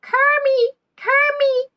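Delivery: high, loud swooning call of Kermie; Miss Piggy. Retold or imitated. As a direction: call out to Kermit like a smitten pig puppet, way up high. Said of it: "I do a better Miss Piggy impression".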